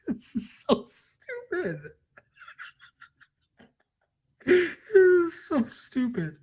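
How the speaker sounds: high, slow, teary laughing; crying laugh. Real, not acted. Fan crying over Spider-Man's sacrifice, half-laughing. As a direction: laugh through real tears. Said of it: "this movie's so emotional".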